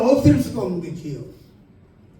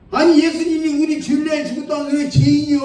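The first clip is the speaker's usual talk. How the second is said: higher and louder, pressing hard; louder final question; 7 semitones higher, 5 dB louder than usual. Retold or imitated. Preacher asking the elder one last time, loud and higher. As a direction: hit it with full exasperation.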